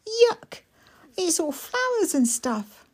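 put-on high, eager small boy; little boy voice. Mikey asking his sister, high and eager. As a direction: ask it wide-eyed like a small child.

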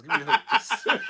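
laughing through a villain line; laughing Burns line. Pretend. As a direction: giggle out the order. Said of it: "Mister Burns".

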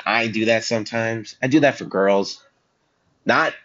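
ordinary delivery

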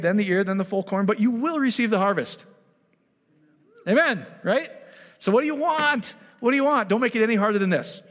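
high, loud, driving preacher's cry; a revival preacher at full force, lifting the room; full-force preaching. Acted. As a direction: preach it out to the crowd with fire.

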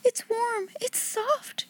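put-on high, very soft, near-tearful girl; almost crying with happiness; a little girl choking back grateful tears; nearly sobbing. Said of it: "she almost sobbed".

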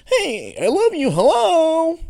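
high, loud, sing-song hello; hammed-up lovey hello; mock cheesy greeting. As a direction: overdo a sappy Valentine greeting.

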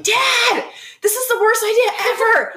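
high-pitched mock yell at dad; imitated yelling. Christy yelling at her dad from the canoe, imitated yell. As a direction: yell it out as a protesting daughter. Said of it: "Christy starts yelling".

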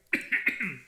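choking cough; coughing fit. Real, not acted. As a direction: cough hard as if something went down wrong. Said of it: "I'm choking".

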